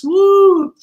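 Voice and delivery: high, loud celebratory woo; excited whoop. Real, not acted. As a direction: whoop it up with delight. Cheering her early bird price.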